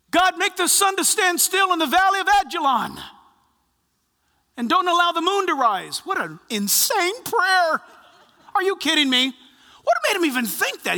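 loud, high cry of prayer; preaching. Acted. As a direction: cry out to heaven. Crying out Joshua's prayer.

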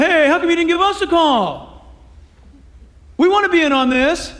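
whiny complaint. Acted. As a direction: whine to be let in.

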